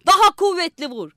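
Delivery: high, loud shouted order; shouting. A narrator's reading. Young man shouts at the band drummer, loud. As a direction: yell at the drummer to hit harder. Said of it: "davulcusuna bağırıyordu".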